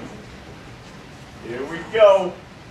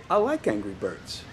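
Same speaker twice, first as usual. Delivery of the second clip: low, soft demonstration; calm model phrase; 11 semitones lower, 10 dB quieter than usual. Shown as a demonstration. Teacher showing Tito what to say, low and soft. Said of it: "just say".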